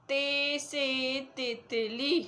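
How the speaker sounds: ordinary talk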